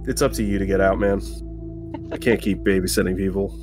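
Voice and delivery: usual speaking voice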